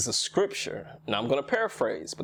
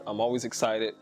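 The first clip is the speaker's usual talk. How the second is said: usual speaking voice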